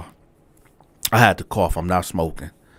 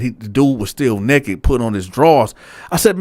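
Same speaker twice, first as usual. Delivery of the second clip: usual speaking voice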